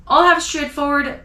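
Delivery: usual speaking voice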